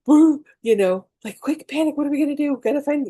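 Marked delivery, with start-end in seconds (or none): 0.1-0.4 sharp gasp and a loud high woo; panicked gasp. Retold or imitated. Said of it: "Anytime something stressful would happen". 1.4-3.1 higher, hurried mock panic. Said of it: "I was a very reactive person".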